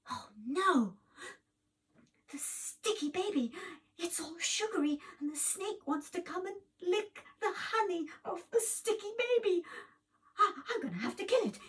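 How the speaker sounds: high, rising panicky cry, rushing at the end; fretful high-pitched hero voice; panicked hero. Acted. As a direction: squeal in alarm for the baby. Hero panics over the baby.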